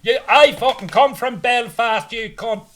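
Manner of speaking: high, loud, slow angry retort; an angry heckler walking out; angry Belfast man. Retold or imitated. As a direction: snap back like an offended Belfast local. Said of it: "One guy got really angry".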